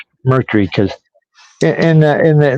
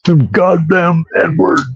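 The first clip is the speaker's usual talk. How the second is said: higher, slow, drawn-out mock outburst; mock presidential cursing; 5 semitones higher, slower than usual. Host mimics presidents on the tapes, mock cursing. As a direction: mimic a cursing politician, stretched out. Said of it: "they said the N-word a lot".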